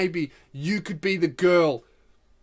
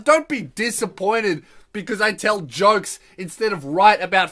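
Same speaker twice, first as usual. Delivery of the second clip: loud, raised-pitch exasperated argument; indignant rant; 4 semitones higher, 6 dB louder than usual. Retold or imitated. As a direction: argue your case, offended and loud. Offended rant at the woman's disappointment.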